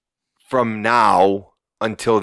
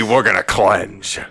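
usual speaking voice

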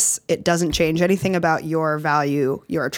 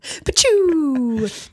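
sound effect; 9 semitones higher than usual. Pretend.

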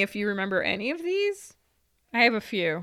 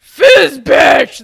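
loud mock shout; 6 semitones higher, 16 dB louder than usual. Kid repeating swear loudly.

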